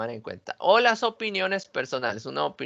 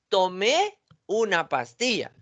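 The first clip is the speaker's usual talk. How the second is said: "Tomé" con el golpe en la é final, despacio; acento en tomé; 4 semitones higher, slower than usual. Shown as a demonstration. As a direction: alarga y sube en la é de "Tomé". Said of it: "el hecho de agregarle acento". ("Tomé" acentuado al final, pausado)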